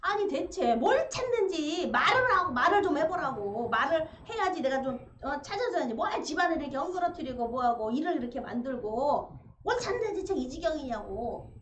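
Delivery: loud exasperated scolding; exasperated wife scolding her husband; curt nagging. Retold or imitated. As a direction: scold him loudly, fed up with the mess. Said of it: "퉁명스럽게 할아버지한테 그렇게 말을 했".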